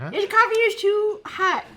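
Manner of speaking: high, slow lady voice; complain, high and drawn out like a fussy lady; lady impression. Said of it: "This is my lady impression".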